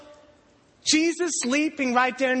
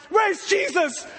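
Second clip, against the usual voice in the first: high, urgent call; alarmed shout; 10 semitones higher, slower than usual. Retold or imitated. Frightened disciple calling out for Jesus. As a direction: shout it over the storm.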